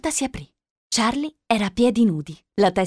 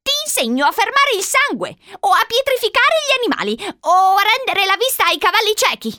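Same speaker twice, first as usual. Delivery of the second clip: put-on high-pitched, insistent old-lady voice; old woman enticing; 11 semitones higher, 3 dB louder than usual. A narrator's reading. Old woman tempting Charlie with powers, enticing. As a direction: pitch each marvel up high.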